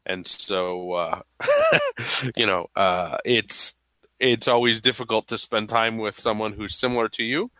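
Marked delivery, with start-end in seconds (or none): none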